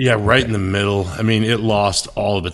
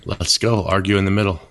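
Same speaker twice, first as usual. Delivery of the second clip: ordinary talk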